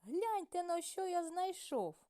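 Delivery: proud rooster calling everyone to look; excited rooster. A narrator's reading. Rooster shows his find.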